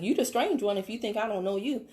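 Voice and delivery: usual speaking voice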